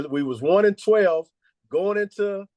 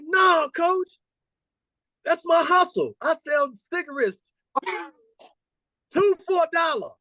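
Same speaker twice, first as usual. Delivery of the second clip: much higher put-on young player, proudly explaining; imitating a young player, much higher, calling out the first words; player's voice. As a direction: pitch it up like a kid proud of his hustle. Coach voicing his player.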